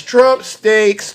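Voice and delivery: ordinary delivery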